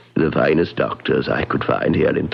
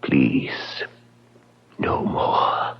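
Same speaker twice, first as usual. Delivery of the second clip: broken plea; 3 semitones lower, 4 dB quieter, much slower than usual. Acted. Servant begs him to reconsider.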